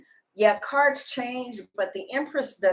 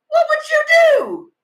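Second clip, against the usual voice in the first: even higher repeated plea with the stress on What; 10 dB louder than usual. Real, not acted. Asking viewers again, higher and louder, stressing What. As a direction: cry What out at the top of the voice.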